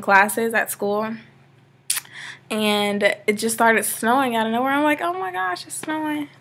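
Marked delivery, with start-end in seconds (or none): none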